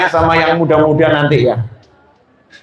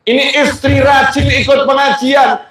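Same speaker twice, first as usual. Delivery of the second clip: ordinary talk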